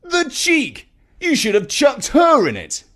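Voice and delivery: appalled at her nerve; outraged. Acted. Jeff telling Nigel what he should have done, outraged. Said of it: "even Jeff was outraged".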